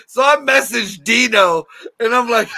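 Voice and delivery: ordinary delivery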